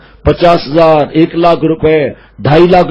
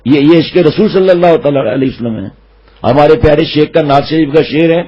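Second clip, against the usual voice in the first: ordinary talk, nothing marked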